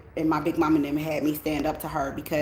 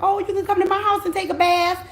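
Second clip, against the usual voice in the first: much higher, sugary mock invite to a stranger; mock sweet mother; 14 semitones higher than usual. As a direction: imitate mom sweet-talking a homeless dude. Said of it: "and be like".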